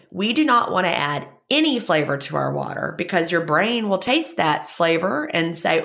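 normal talking voice

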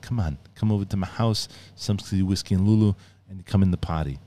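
casual put-on Austrian accent; easygoing chat in a mock Austrian accent; Arnold. Inviting someone over to the house, in an Austrian accent. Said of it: "sometimes he just talks like this".

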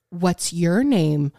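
put-on very slow, low, awkward non-baby talk; stilted normal voice. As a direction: talk to a dog like you forgot how. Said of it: "forgot how to talk, not baby talk".

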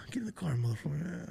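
high unvoiced whisper; whispered taunt. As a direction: hiss the taunt under your breath.

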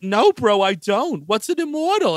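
loud protest rising high; hammed-up confused denial; mock bewilderment. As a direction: play a clueless bro, baffled. Acting out the forgetful hero's exasperating reply.

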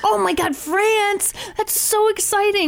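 squealing with excitement; excited. Retold or imitated. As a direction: gush over it, high and thrilled. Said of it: "was like".

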